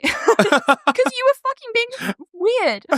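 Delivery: squealing with laughter; laughing. Real, not acted. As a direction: crack up through the words. Laughing at retold exchange.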